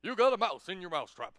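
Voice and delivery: loud, exclaiming statesman impression; booming presidential surprise; President Obama. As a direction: announce the discovery in a big voice. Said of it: "and he goes".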